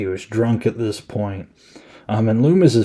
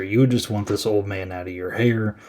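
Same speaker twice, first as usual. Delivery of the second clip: ordinary talk, nothing marked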